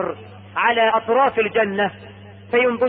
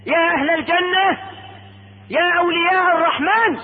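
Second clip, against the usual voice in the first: high, ringing herald's call; 7 semitones higher than usual. Acted. Caller hailing the people of Paradise, calling out. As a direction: hail them with stretched vowels. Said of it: "ينادي منادي".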